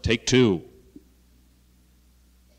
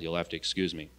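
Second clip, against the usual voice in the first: usual speaking voice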